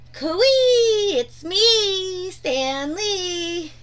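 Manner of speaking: calling out. A narrator's reading. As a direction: call into the cave with a smile. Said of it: "he called with a smile".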